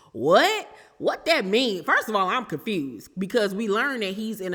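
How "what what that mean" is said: high, loud disbelieving what; shocked double take; incredulous, pitched-up question; surprised. Real, not acted. Reacts to a shocking answer.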